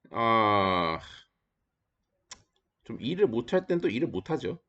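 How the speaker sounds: ordinary delivery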